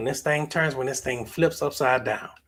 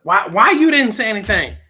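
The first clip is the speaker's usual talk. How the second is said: loud, raised-pitch questioning; acted-out indignant why; baffled challenger; 8 semitones higher, 13 dB louder than usual. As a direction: demand an explanation, loudly. Acted questioner challenging the silent worshiper.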